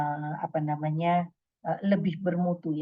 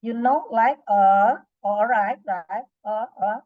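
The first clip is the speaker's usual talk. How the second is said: filler words demo; 5 dB louder, slower than usual. Shown as a demonstration. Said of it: "Atau filler words".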